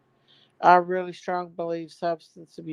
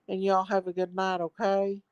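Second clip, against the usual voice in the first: ordinary talk, nothing marked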